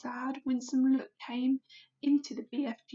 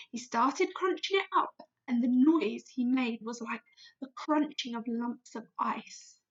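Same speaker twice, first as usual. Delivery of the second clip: plain reading voice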